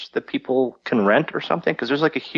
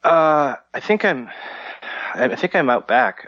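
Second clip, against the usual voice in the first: ordinary talk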